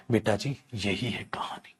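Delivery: unvoiced whispered aside. Real, not acted. Teacher whispering the rule to students. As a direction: whisper it to the class.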